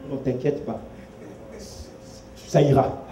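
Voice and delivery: soft, hushed reassurance to a worried friend; a quiet, gentle calm-down; Jesus reassuring Peter. Acted. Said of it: "Jésus rassure donc Pierre".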